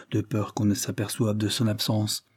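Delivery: plain reading voice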